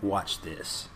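low, soft mock bravado; acting big and bad. Man facing the goblins, acting big and bad. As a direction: drop it low, playing tough. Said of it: "gonna act all fucking big and bad".

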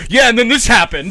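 loud, high burst cutting in; mock eager interruption. Friend mimicking his co-host jumping into a story, loud and eager. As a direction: imitate someone jumping into the story. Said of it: "you're like".

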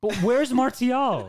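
high, slow laughing question; laughing disbelief. Real, not acted. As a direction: ask it through laughter.

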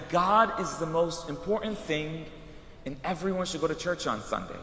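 slow, soft and deliberate; slow, hushed preaching tone; slow and solemn. Retold or imitated. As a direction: lay it down slowly like a lesson. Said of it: "Why did I say it that way?".

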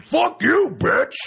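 high, loud mock yell. Mayor yelling at the councilwoman, loud and high. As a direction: bark it up high in a put-on voice. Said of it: "He goes".

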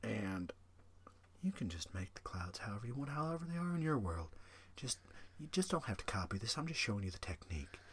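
soft, hushed painting-teacher voice; Bob Ross voice. Retold or imitated. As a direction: gently coax like a TV painter.

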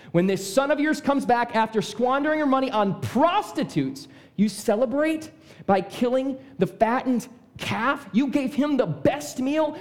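high, indignant outburst with drawn-out key words; angry. Acted. Jealous older brother disowning his brother to his father, angry. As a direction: rail in outrage, stretching the bitter words. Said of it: "The older brother was angry".

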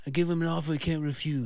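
fast, raspy mob boss voice; a quick Brando Godfather line; Godfather impression. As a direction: mumble it like the Godfather. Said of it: "Marlon Brando, Godfather".

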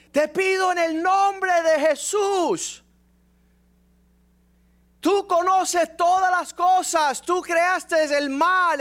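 high, loud, slow mock prayer voice of an old Pentecostal woman; hammed-up old woman calling on God; praying old woman. Imitating the old lady's prayer.

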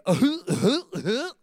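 loud, swooping dopey chuckle; goofy mock laugh. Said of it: "Adam was like Huckleberry Finn".

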